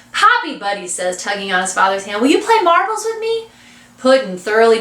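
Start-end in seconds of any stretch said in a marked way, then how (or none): 0.1-0.6 put-on high-pitched child's voice; pleading little boy. A narrator's reading. Buddy tugs his father's hand, pleading childishly. 2.2-3.5 put-on high-pitched child's voice; pleading little boy. A narrator's reading. Buddy tugs his father's hand, pleading childishly.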